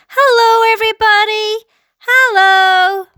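high, loud, drawn-out hail; calling out. A narrator's reading. Small boy waving to everybody below, calling out. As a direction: call out to a crowd far below. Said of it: "laughing and waving and calling out".

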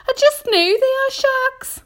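high, loud, fearful certainty; alarmed earthworm. A narrator's reading. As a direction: insist loudly in a high worm voice. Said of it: "cried the earthworm".

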